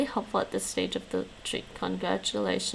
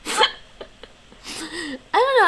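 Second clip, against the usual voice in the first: laughing; 9 semitones higher, 5 dB louder than usual. Real, not acted.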